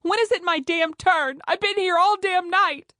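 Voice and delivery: high, loud yell of an impatient patient; angry shout. Acted. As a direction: bellow it across the waiting room. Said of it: "she heard a patient shout".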